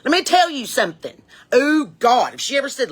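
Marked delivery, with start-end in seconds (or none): 0.0-1.2 higher-pitched imitation of an upset mom; upset mother. Mother laying down the law, mock upset. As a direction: lay down a mock warning. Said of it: "my mother would get upset".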